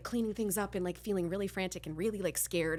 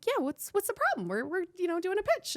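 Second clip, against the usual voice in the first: nervous, pitched way up; voice goes up; 12 semitones higher than usual. Retold or imitated. To the assistant, nervous high-pitched voice. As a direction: squeak it out high and innocent. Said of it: "my voice goes up".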